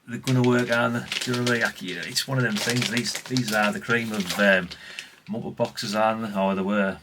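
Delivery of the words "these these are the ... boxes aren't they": normal talking voice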